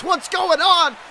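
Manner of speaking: normal talking voice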